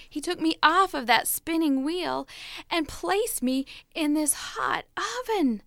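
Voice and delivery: ordinary delivery, nothing marked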